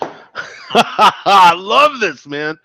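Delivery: loud, high laugh stretching into the words; delighted laughing. Real, not acted. Delighted laugh after tasting the beer.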